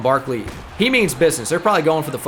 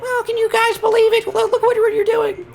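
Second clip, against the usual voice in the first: much higher squeaky cartoon-like voice; Jalen Hurts impression. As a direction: squeak it out like Mickey Mouse. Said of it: "It's my Jalen Hurts impression".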